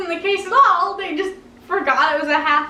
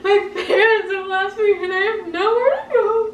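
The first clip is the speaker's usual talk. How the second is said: half-laughing mock sob, pitched up; retold wailing; 4 semitones higher than usual. Kid locked out of school, hamming up her sobbing. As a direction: wail it, laughing at your younger self. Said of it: "I was crying".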